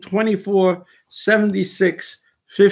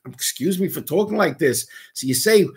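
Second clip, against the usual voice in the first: ordinary talk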